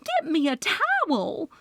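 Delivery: high, flustered plea for a towel; flustered cry. A narrator's reading. Loretta flustered, calling for a towel, high and urgent. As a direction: flap and call for help. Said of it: "Loretta gasped".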